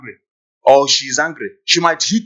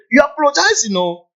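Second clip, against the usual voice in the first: ordinary talk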